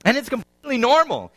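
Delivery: ordinary delivery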